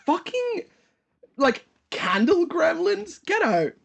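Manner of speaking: high excited squeal. Real, not acted. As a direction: squeal with delight. Excited delight at idea.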